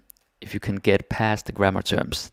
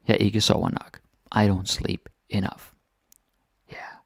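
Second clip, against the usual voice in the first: ordinary talk, nothing marked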